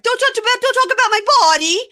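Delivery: very high, loud mock scream; yelling. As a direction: shriek it like an outraged wife. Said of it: "as she's yelling".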